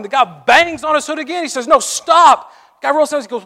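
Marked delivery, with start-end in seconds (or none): 1.7-2.5 high, loud "No, stop" yelled; shouted warning. Retold or imitated. Stranger on the bridge yelling at the driver, loud and urgent. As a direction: yell it to stop a speeding car.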